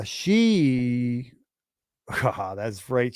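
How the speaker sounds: ordinary talk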